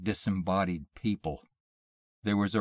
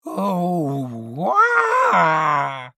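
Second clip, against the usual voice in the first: high, loud drawn-out scream; long wailing shriek; 9 semitones higher, 9 dB louder than usual. A narrator's reading. Thing springing from the dark, shrieking. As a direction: let out a swelling shriek. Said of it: "He heard the shriek, the cry, the scream".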